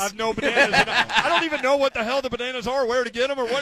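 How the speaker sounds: laughing. Real, not acted. Host laughs along at joke.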